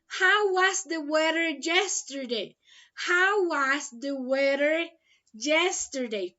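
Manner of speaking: reads the question slowly, word by word; slow and clear. Shown as a demonstration. Teacher reading the question to her online class, slow and clear. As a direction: spell it out slowly for learners. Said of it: "teacher reads question slowly, twice".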